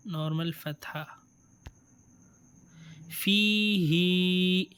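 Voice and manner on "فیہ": فیہ held very long and slow; slow reading demo. Shown as a demonstration. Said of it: "یا مدہ بھی آ گیا ہے".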